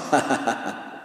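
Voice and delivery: mocking laugh. Mockers laughing at Jesus.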